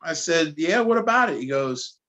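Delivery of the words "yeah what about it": a high, pushy "what about it", bracing for trouble; squaring up, half-joking Jersey toughness; defiant challenge. Retold or imitated. Talking to a stranger he fears may start trouble, with a mock tough challenge.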